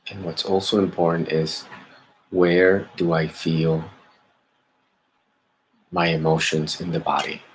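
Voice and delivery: calm, measured meditation guidance with long pauses; guided meditation. Acted. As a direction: gently lead listeners inward, leaving space after each phrase. Leads a meditation.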